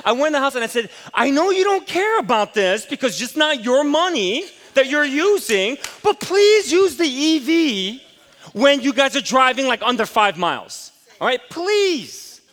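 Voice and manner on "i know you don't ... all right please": loud, high, slow exasperated lecture; retold yelling. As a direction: scold the kids for taking the gas car. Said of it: "I was yelling at them".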